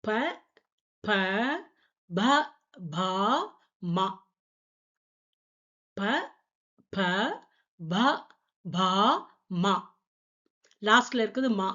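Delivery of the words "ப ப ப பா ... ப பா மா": ப ப ப பா மா spaced and clear, twice over; Hindi pa-row recited. Shown as a demonstration. Teacher to students, reciting the pa row twice. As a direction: say the ப row syllable by syllable. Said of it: "பா line".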